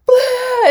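loud high laugh-cry burst; laughing sob. Retold or imitated. To the kind waiter, a sudden laughing cry.

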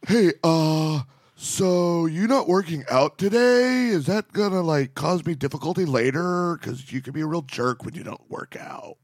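a hint of drunk Donald Trump; mock husband voice. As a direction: imitate the husband's put-on voice. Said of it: "I'm gonna use the voice he probably used".

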